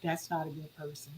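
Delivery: low, very soft verdict; quietly tip off a friend; discreet warning. Acted. Acts out discreet judgment.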